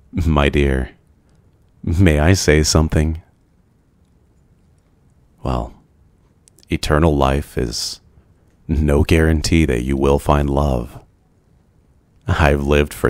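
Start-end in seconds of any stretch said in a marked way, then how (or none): none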